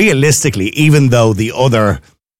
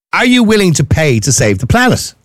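normal talking voice

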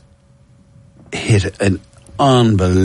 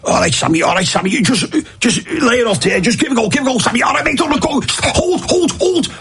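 much higher coaching shouts from the stands; Liverpool fan. Retold or imitated. A Liverpool fan coaching a player from the stands, high and urgent. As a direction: urge the player on like a fan in the seats. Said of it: "the way they talked about".

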